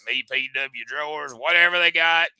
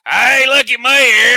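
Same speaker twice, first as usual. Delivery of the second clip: strut in bragging like a vain wrestler; mock Joey Gross; 7 semitones higher, 7 dB louder than usual. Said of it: "so I could come as Joey".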